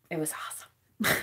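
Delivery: sarcastic whisper; whispered deadpan irony. Real, not acted. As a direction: whisper it like a bitter joke. Sarcastic aside about firing.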